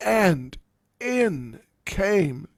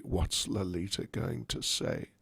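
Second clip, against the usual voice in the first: anxious thought; 8 semitones lower, 9 dB quieter than usual. Acted. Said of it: "Radharani's in anxiety".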